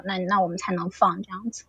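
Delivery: ordinary talk, nothing marked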